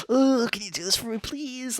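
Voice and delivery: high sugary customer plea; sweetly high fake-polite request; customer service voice. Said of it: "I work in retail".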